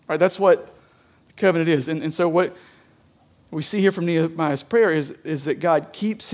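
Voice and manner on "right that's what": usual speaking voice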